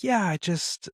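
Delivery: put-on high, small child's voice; imitating a girl tearing up; mildly choked-up, high reply; tearful little daughter. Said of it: "tearing up".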